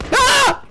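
sharp high startled yelp; real jump-scare cry; scared scream. Real, not acted. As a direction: yelp as the enemy appears. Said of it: "I'm scared".

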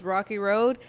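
ordinary talk, nothing marked

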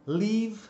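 "leave" said with a long open ee vowel; clear model word. Shown as a demonstration. Teacher modelling "leave" for Brazilian learners. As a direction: model "leave" slowly, stretching the ee. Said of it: "algumas palavras muito legais aí que são".

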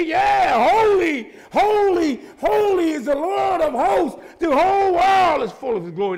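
high, loud, slow "holy, holy, holy"; shouted angel praise. Acted. Second angel answering back, loud and high. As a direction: belt out the answer in awe.

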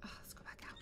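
soft unvoiced whisper; whispering while creeping away; scared whisper. Real, not acted. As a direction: breathe the words out in fear.

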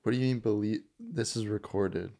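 usual speaking voice

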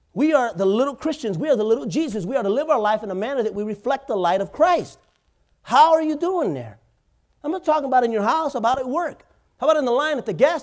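high, loud fired-up preaching. Acted. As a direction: drive it home to the congregation. Preaching at full force.

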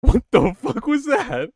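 loud, high, slow disbelief; shocked. Real, not acted. Streamer shocked by a weird animal sound. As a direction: react in stunned shock.

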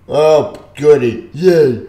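slow, drawn-out mock delight; sarcastic cheer. Real, not acted. Reviewer mocking a plot turn he dislikes, sarcastic. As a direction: cheer with no joy at all.

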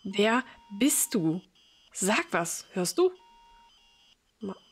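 normal talking voice

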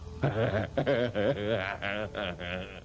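long theatrical cackle; horror host cackle. Acted. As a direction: laugh like a spooky TV host. Host laughs after intro.

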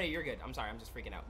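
ordinary talk, nothing marked

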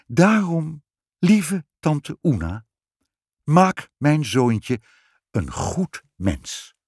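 slow, tender and moved, as if close to tears. Acted. Queen tenderly asks aunt Oena for a kind son, moved voice. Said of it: "met ontroerde stem".

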